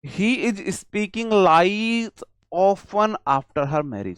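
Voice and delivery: very slow, word by word dictation. Shown as a demonstration. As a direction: read the sentence out slowly for the class. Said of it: "कैसे पहचानोगे आप".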